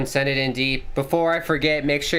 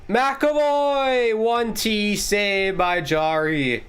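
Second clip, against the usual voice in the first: rising, higher hockey call, faster in bursts; excited play-by-play; 5 semitones higher, slower than usual. Acted. Streamer calls the Bruins attack live, excitedly. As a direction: call the rush like a TV announcer.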